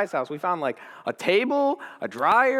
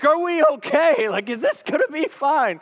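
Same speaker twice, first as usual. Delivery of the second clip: mock nervous worry; panicked body; 10 semitones higher, 5 dB louder than usual. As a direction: voice the body's panic. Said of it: "my body's like".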